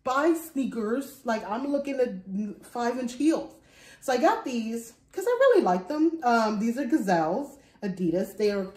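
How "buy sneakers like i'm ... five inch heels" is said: slow defensive protest. Retold or imitated. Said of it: "But I was like".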